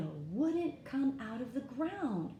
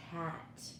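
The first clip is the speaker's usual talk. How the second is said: ordinary delivery, nothing marked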